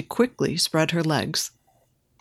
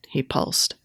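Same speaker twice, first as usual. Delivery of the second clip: usual speaking voice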